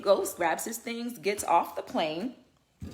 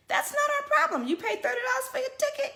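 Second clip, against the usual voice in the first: emphatic rant. Real, not acted.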